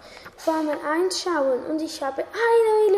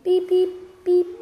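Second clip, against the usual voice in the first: phone beeping; 6 semitones higher, 5 dB louder, slower than usual. Acted. Imitated phone ringing.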